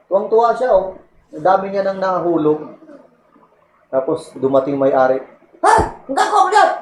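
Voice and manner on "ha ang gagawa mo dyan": high, startled owner's challenge; surprised owner. Retold or imitated. Owner discovers the coconut thief, startled high challenge. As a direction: catch the thief in the act.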